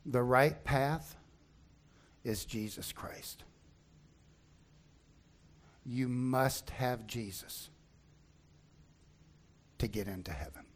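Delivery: solemn appeal. Real, not acted. Closing appeal of sermon.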